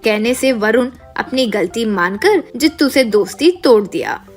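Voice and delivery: usual speaking voice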